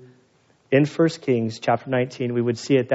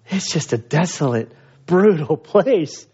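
laughing; 3 semitones higher, slower than usual. Real, not acted. Jokes about Mongolia, laughing.

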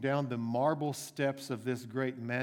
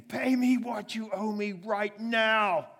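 furious demand; 8 semitones higher, 6 dB louder, slower than usual. Acted. Choking the debtor, spitting.

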